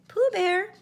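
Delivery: high, small polite call from behind; a timid little voice calling the bear's name; quiet polite voice. A narrator's reading. Small voice of Roo calling Pooh. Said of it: "a quiet voice saying".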